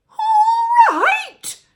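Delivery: very high, very loud outraged shriek; grandma yelling at George; indignant yell. A narrator's reading. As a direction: shriek back in disbelief. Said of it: "she yelled".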